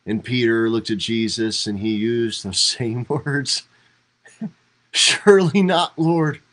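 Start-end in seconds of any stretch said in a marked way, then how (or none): 4.9-6.4 high, loud anguished protest; emphatic protest. Retold or imitated. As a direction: cry it out in disbelief, higher and louder. Peter protests Jesus' death.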